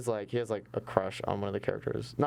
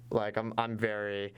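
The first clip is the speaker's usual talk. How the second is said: usual speaking voice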